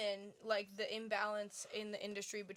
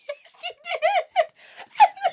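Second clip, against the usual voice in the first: laughing; 17 dB louder, much slower than usual. Retold or imitated. Kids laughing at mom's slip.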